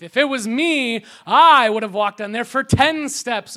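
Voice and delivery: loud bragging boast; boastful brag. Retold or imitated. Critics boasting they'd do better, loud brag. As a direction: puff yourself up over Peter. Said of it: "we always use this scripture to go".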